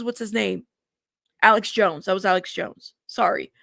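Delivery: normal talking voice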